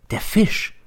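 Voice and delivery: startled whisper. A narrator's reading. Teacher jolted by T's stare, startled whisper. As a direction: gasp the realisation. Sudden shocked realisation.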